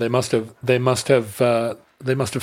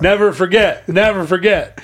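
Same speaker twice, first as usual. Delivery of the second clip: higher, louder, mock-grave vow; mock solemn rallying cry; 6 semitones higher, 4 dB louder than usual. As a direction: declaim it like a battle cry.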